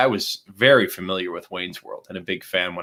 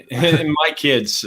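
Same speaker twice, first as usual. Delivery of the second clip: laughing; 8 semitones higher, 5 dB louder, much slower than usual. Real, not acted. Laughing while telling about kids.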